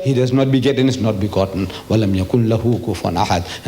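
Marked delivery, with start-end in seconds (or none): 1.9-3.7 rapid, lower Arabic recitation; Quranic recitation. Shown as a demonstration. Preacher closing the verse for the crowd, fast and lower. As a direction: recite it quickly and drop the voice down. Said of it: "he doesn't talk like this".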